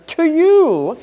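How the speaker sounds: "to you" pushed high and loud; the key phrase lifted high and loud; stress on to you. Shown as a demonstration. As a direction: hit "to you" hard. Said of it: "an emphatic sense".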